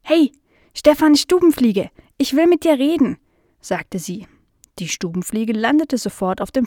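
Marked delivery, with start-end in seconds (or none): 0.0-3.2 higher, louder call for attention. Acted. Nala trying again to talk to the fly, calling out. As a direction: call firmly across to someone who isn't listening.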